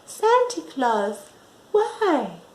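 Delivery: put-on high, small child's plea; a small girl's high, pleading why; little girl voice. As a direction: ask like a tiny puzzled girl. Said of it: "she said".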